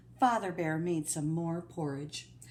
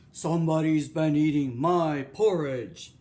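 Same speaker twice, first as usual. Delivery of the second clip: loud, gruff Papa Bear growl; 2 semitones lower, 9 dB louder than usual. Acted. Papa Bear finds his porridge eaten, gruff growl. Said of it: "great gruff, growling voice".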